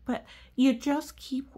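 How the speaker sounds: plain reading voice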